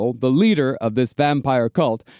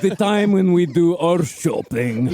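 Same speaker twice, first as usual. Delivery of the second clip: mock vampire voice; 2 semitones higher than usual. Parodying vampire clan speech.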